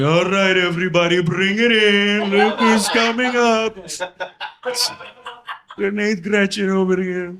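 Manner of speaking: high, loud, slow coach call; mock little league coach. The enemy mocks Sanchez, calling like a little league coach. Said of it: "that little league thing".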